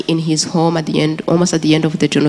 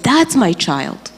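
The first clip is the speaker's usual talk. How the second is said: slow, steady, moderately raised claim; the woman calmly claiming her as her own; 6 semitones higher, slower than usual. Retold or imitated. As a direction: say it slowly and firmly, without screaming. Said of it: "and she said".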